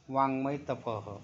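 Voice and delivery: ordinary talk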